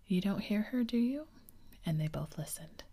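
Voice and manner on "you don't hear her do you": impatient question, higher at the end; mildly impatient, pitch climbing into the question. A narrator's reading. Peter asks Wendy about the missing fairy, mildly impatient. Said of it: "he said impatiently".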